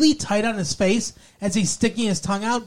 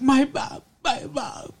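mock sobbing; 5 semitones higher, 4 dB quieter than usual. Voicing heartbroken Donna.

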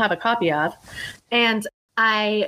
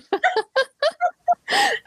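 shrieking giggle, much higher; high squealing laugh. Real, not acted. Guest cracking up at her angel story, high squealing laugh.

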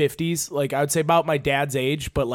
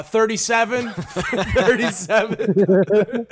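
higher-pitched mock counter call; imitated order caller; 5 semitones higher, much slower than usual. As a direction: call an order number through laughter, drawn out on the repeat. Mimics worker calling order number.

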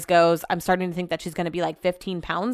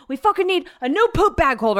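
mock-mad complaint in a much higher voice; Brett; 10 semitones higher than usual. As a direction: imitate him fuming, pitched way up. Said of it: "he's mad at me".

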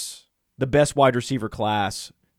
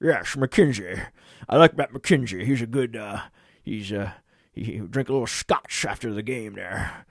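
attempted Scottish accent; 4 semitones lower than usual. Host riffing on McKenzie, in a bad Scottish accent. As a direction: stumble through a mock Scottish brogue. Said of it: "I try to do Scottish".

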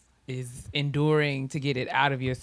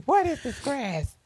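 put-on high, baffled old grandmother; 12 semitones higher than usual. Grandmother opening a box of herbs, high and baffled. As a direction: puzzle over it like a suspicious granny. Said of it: "what my grandmother's gonna say".

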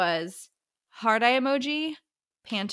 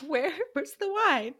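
desperate; 7 semitones higher than usual. Pretend. Mock desperate craving for wine.

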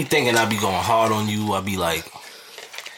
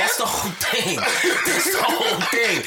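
laughing; 10 semitones higher, slower than usual. Real, not acted. Laughing while speaking.

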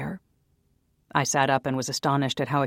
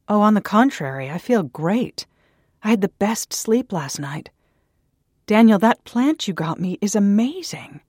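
higher, louder bright bluff; forced cheer; 6 semitones higher, 6 dB louder than usual. A narrator's reading. Naomi brushing off Daniel's worry, forced cheer. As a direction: oversell how great you feel.

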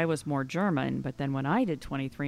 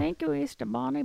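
ordinary talk

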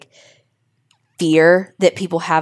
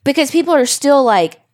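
ordinary delivery

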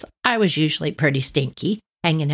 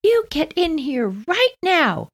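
much higher, slow, stretched full-name summons; stern mother calling; 14 semitones higher, much slower than usual. Retold or imitated. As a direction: mom calls him in, no arguing. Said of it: "mother summons son by full name".

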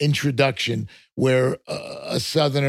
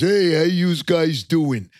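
drawn-out cartoon New York accent; slow, exaggerated New York greeting; New York caricature; 3 semitones higher, slower than usual. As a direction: lay on a thick New Yorker caricature.

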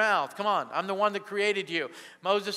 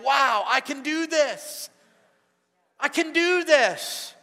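slow, higher, louder wonder that builds; slow growing awe, higher and louder at the end; rising confidence; 5 semitones higher, 4 dB louder, slower than usual. Acted. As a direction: savor it as you find your courage. Acting out a believer's new confidence.

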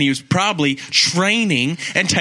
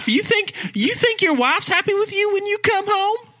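high mock scold.

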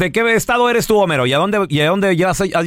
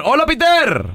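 lively radio greeting; 9 semitones higher than usual. Real, not acted. Host greeting caller on air.

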